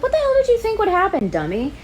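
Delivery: loud, high, fast indignant yell; imagined shout at the character; exasperated retort. Retold or imitated. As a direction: tear into her in disbelief. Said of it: "I'm like".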